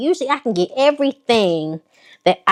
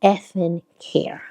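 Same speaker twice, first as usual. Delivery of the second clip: whispered aside; 5 dB quieter than usual. Real, not acted. Whispered aside about haters.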